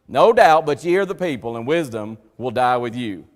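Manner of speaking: preacher booming Job's sarcastic retort; loud sarcasm. Retold or imitated. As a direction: throw the sarcasm out at full voice. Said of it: "That's sarcasm to the nth degree".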